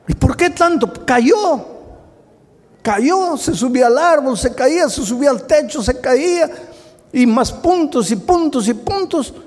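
high, far-off voice of a fretting mother; child's mother. Acted. Preacher voicing a mom listing her son's falls. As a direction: squeak it out like a worried mom.

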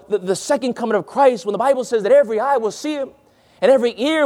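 excited high-pitched preaching, rushing on. Shown as a demonstration. Preacher describing Christ's second coming to the congregation, fast and fervent. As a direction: drive it forward with rising excitement. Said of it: "make my voice a little bit louder".

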